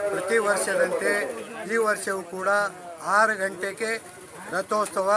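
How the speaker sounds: loud, measured public address; formal speech. Real, not acted. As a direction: announce it formally in short, separated phrases. Formal festival address to camera.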